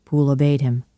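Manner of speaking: usual speaking voice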